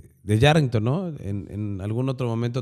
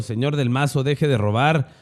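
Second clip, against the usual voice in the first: louder, higher mock shout; imitating angry heckler; 4 semitones higher, 4 dB louder than usual. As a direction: voice a retold accusation, building in pitch and volume. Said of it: "le gritó de todo".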